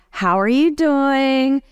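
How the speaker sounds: high, slow, sing-song hello to a patient; mock-casual bedside greeting; breezy doctor greeting. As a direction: stroll in all casual and cheery. Doctor greets patient, acted quote.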